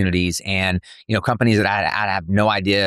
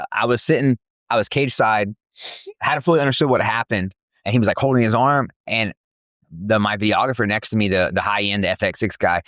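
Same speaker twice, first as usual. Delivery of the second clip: usual speaking voice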